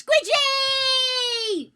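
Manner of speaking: high, loud, drawn-out call of a name; yelling a greeting. Real, not acted. Streamer greets her friend Squidgy in chat, loud high yell. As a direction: shout the name in delight. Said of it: "I've immediately started yelling".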